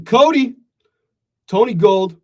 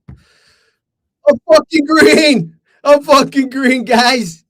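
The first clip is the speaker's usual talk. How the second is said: high, loud and slow cheer; triumphant yell; 12 semitones higher, 8 dB louder, much slower than usual. Real, not acted. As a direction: crow it out in triumph. Celebrating a winning trade.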